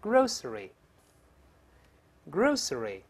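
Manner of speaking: grocery drawn out slowly, with a pause for repeating; read-aloud drill. Shown as a demonstration. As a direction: model "grocery" slowly in full syllables. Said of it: "我们大声来一遍".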